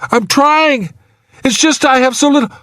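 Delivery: high, hurried, strained protest; desperate straining. A narrator's reading. Trapped man struggles for the hook, desperate. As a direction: rush it out in a high voice.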